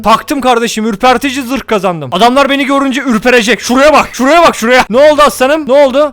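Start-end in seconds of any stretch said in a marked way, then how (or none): none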